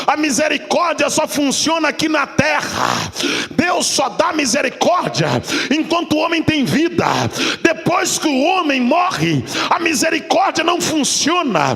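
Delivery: usual speaking voice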